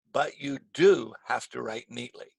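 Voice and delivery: long, emphatic "do"; stress on DO. Shown as a demonstration. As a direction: lean on "do" against what isn't required. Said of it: "use it to make a contrast".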